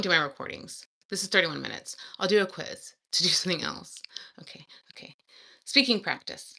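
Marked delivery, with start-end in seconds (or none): 4.4-5.1 voiceless whispered okay; whispering. Real, not acted. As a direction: whisper it to yourself. Whispered self-talk.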